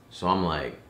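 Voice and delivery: normal talking voice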